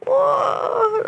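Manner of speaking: usual speaking voice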